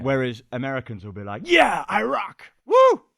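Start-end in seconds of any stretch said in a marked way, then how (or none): 1.5-3.0 put-on loud, high, slow brash American accent; over-the-top American accent cheering himself. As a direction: whoop it up like a cocky American. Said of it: "That was my American accent".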